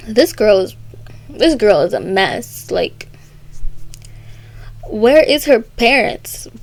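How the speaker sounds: normal talking voice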